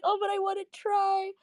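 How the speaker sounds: high, small-voiced imitation of a disappointed friend; mock sad. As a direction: plead softly up high, imitating her letdown. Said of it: "she got so sad".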